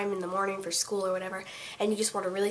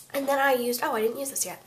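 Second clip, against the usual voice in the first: ordinary delivery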